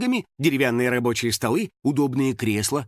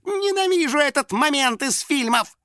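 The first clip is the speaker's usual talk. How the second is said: high, fretful wail; Финик panicking; 12 semitones higher than usual. A narrator's reading. Финик facing oncoming chainsaws, panicking. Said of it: "запаниковал Финик".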